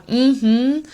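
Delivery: rising, encouraging mm-hmm; listening mm-hmm. Shown as a demonstration. Tutor models a listening sound for the patient. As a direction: nudge them to keep talking. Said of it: "Yes, I'm listening".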